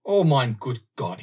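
loud, slow anguished cry; a loud, slow German-accented outcry; shouted. Acted. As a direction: shout it out in dismay. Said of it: "he shouted".

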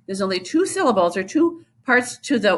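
ordinary talk, nothing marked

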